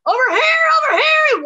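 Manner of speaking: high, loud calling across distance; acted yell. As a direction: holler to someone lost in the woods. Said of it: "shouting real loud".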